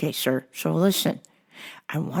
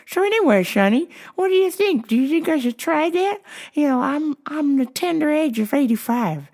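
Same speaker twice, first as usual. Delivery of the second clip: put-on high-pitched, chummy old man; chatty old customer; 10 semitones higher, 3 dB louder than usual. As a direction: confide in the clerk, pitched up high. Voices old customer in story.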